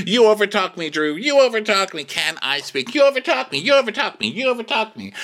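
high, hammed-up repeated complaint; mock protest. Cast member talked over by Drew, mock outraged. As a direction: imitate someone fighting to get a word in.

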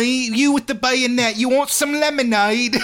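high, prim English schoolboy; posh schoolboy voice. Pretend. Schoolboy offering lemonade to a soldier, high and prim. Acted skit at boarding school.

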